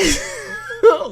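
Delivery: high burst of embarrassed laughter. Real, not acted. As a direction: laugh it off, caught out. Laughs at herself.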